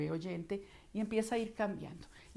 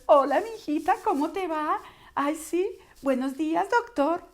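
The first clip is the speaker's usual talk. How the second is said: much higher and louder, larynx pushed up high; high squeaky voice; 9 semitones higher, 10 dB louder than usual. Shown as a demonstration. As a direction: talk as if the larynx wore high heels. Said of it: "una voz que suena aguda y chillona". (voz aguda y chillona, laringe en tacones)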